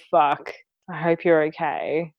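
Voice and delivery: normal talking voice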